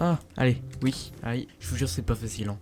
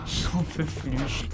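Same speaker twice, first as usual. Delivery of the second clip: slow weary sigh; much slower than usual. Real, not acted. Exhausted after long boss fight.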